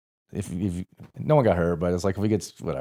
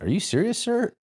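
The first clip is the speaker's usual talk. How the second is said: mock-incredulous, pitch shooting up on sir; sarcastic question to the captain; smart-ass; 14 semitones higher than usual. Corporal questioning his captain's order, smart-ass rising tone. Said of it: "kind of a smart-ass way".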